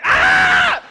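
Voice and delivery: shrill, high, loud mock scream; jump-scare shriek like a costumed witch; a sudden high retold scream. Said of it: "they lift their head and scream".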